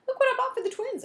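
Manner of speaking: high, loud and proud; excited boy. Acted. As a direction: show off the new toys with a thrill. Eric proudly shows his purchase.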